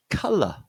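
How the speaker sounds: "colour" with stressed uh as in cut, falling to schwa; short uh vowel. Shown as a demonstration. Teacher presenting the second word, "colour" with uh vowel. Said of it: "that sound is an uh sound".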